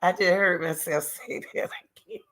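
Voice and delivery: whispered through laughter at herself; breaking up laughing at her own words. Real, not acted. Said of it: "I'm laughing".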